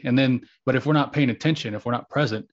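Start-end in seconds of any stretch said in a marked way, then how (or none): none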